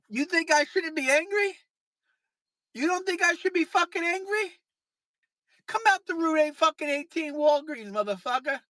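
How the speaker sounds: furious call-out to a chatter; angry taunt. Real, not acted. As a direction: dare them to come out.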